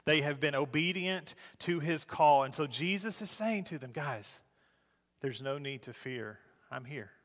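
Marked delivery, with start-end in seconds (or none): 3.9-7.1 gentle reassurance. Acted.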